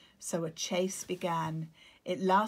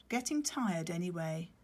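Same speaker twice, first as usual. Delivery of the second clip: usual speaking voice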